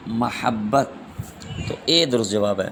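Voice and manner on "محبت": محبت as "ma-HAB-bat", three clear syllables; correct pronunciation. Shown as a demonstration. Teacher confirming the answer, careful "mahabbat" for محبت. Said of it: "تو درست لفظ ہے".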